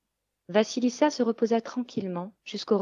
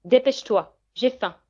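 higher, louder hungry witch; impatient witch; 4 semitones higher, 5 dB louder than usual. A narrator's reading. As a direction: bark the order impatiently. Sorcière affamée ordonne. (sorcière impatiente)

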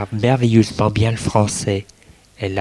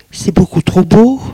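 amazed; 9 semitones higher, 5 dB louder than usual. Acted. Katia replies, overwhelmed.

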